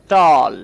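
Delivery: "টল" loud, with a drawn-out "aw"; long vowel demonstration. Shown as a demonstration. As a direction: stretch the "অ" in "টল" for learners. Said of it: "আবার যদি বলি".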